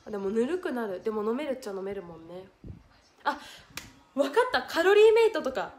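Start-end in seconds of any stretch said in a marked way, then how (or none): 3.2-5.7 much higher and louder, blurting out the idea; excited idea. Real, not acted. Hit on a plan for viewers, excited blurt.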